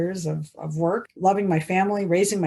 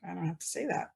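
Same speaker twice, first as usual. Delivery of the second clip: much softer, said privately to yourself; quiet self-assurance; 12 dB quieter, faster than usual. Shown as a demonstration. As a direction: murmur it like a private realization. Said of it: "awesome to sit there and go".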